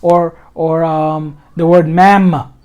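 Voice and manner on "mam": mam louder, final m released; hitting it. Shown as a demonstration. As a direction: hit the closing m of mam. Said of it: "you're hitting the, you're hitting it".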